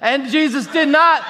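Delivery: normal talking voice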